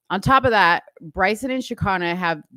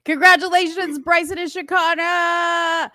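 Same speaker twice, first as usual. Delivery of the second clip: excited cheer; 11 semitones higher, 5 dB louder than usual. Real, not acted. Cheering the newlyweds.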